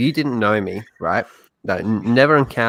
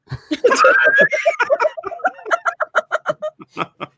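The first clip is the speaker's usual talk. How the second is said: long high-pitched laughing fit; real sustained laughter. Real, not acted. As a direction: crack up in high, helpless peals. Laughs at the rejection.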